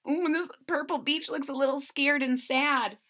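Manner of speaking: usual speaking voice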